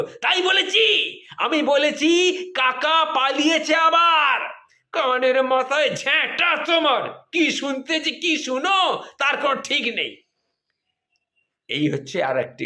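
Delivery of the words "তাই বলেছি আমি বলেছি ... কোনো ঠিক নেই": hammed-up shrill wife yelling; mock shouting wife. Wife yells at husband for mishearing, higher mock shout. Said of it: "দ্বিগুণ চিৎকার করে উঠে বলল".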